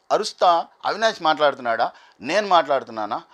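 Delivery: normal talking voice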